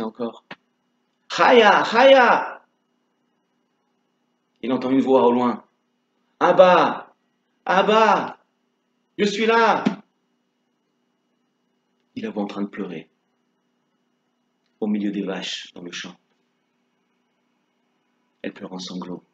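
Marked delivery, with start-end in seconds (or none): 1.3-2.6 high call across the field at night. Acted. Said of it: "Et il crie". 6.4-9.8 answering call. Acted. Chaya répond de loin. 12.2-19.2 sad hush. A narrator's reading. Récit des pleurs de Chaya.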